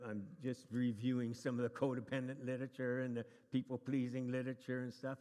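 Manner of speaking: normal talking voice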